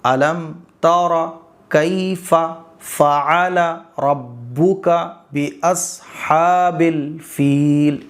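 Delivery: very slow, word by word, drawn out; slow recitation. Shown as a demonstration. Teacher showing the correct slow way to recite. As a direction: give each word its own space. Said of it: "کیسے؟".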